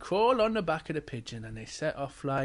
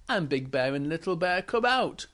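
ordinary delivery

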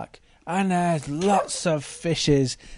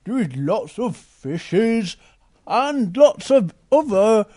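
Big Bear voice; slower than usual. Acted. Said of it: "Big Bear going".

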